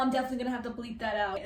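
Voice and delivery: ordinary talk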